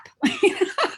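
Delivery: high, loud laughing burst; burst of laughter. Real, not acted. Remembering her feisty ashram arrival, a loud high laugh.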